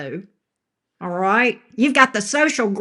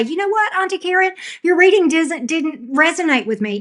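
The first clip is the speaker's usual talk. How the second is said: high-pitched mock complainer; mocking quote; 6 semitones higher than usual. Streamer quoting a viewer to Auntie Karen, mocking high voice. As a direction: mimic the complaint in a higher voice.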